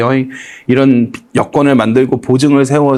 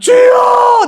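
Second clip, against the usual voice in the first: high, loud tearful shout; high, loud cry of Lord; crying out to God; 4 dB louder, much slower than usual. Retold or imitated. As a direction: call out to the Lord in tears. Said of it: "내가 그래서".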